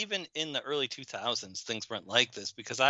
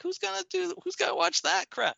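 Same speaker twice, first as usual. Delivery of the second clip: scoffing. Retold or imitated. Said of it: "And I was like".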